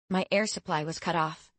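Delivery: usual speaking voice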